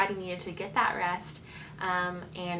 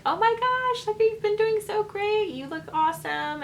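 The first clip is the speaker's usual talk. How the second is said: much higher and louder, bubbly praise; gushing cheerleader doctor; 14 semitones higher, 5 dB louder than usual. Retold or imitated. Doctor cheering on the mother in labor, high excited gush. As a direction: gush encouragement like an overexcited cheerleader. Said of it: "you can hear her in the vlog just being like".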